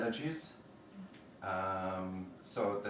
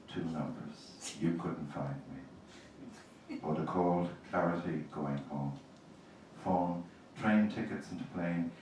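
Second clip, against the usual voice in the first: slow, grave elegy reading; poetry reading; 5 semitones lower than usual. Acted. Poet reads an elegy for her dead to the audience, gravely.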